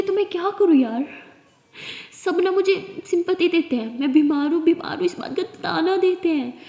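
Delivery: anguished, frustrated venting; bitter outburst. Acted. Bitter outburst about illness.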